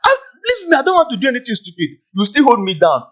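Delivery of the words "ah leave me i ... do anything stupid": sharp high cry then fast words; exasperated cry to be let go. Retold or imitated. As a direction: wrench free and rush it. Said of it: "You see, you are not going anywhere".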